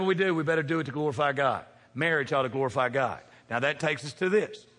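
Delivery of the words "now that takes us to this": ordinary talk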